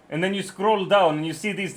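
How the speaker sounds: ordinary talk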